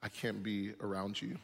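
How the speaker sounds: mild frightened plea to leave; Peter's fear. Retold or imitated. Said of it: "Peter would look at Jesus and go".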